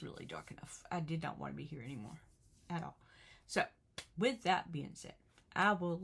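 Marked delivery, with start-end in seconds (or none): 0.0-3.0 hushed confession of despair, barely voiced; whispered admission of despair. Real, not acted. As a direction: drop to a whisper, ashamed of the memory. Confessing suicidal darkness.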